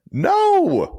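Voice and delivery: loud-leaning, high-pitched "No"; emphatic denial. Real, not acted. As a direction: deny it with a big pitch jump. Emphatic denial of being frustrated.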